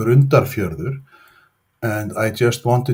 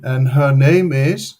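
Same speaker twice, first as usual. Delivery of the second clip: usual speaking voice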